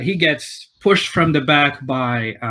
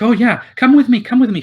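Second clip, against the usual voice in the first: higher, louder, excited invitation; eager welcome; 9 semitones higher, 6 dB louder, faster than usual. Retold or imitated. Innkeeper's wife leading the guest to a table, high and eager. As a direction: pull the guest along eagerly. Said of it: "She's like".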